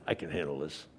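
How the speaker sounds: low, hushed self-assurance; Peter's cool bravado. Acted. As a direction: mutter it to yourself, playing confident. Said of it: "loudmouth Peter says".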